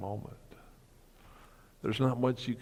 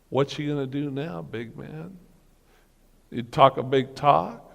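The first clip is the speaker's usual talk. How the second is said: higher, louder taunting jab; jeering self-mockery, higher and louder; mocking taunt; 5 semitones higher, 6 dB louder than usual. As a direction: sneer at your own big talk. Mocking himself after paralysis.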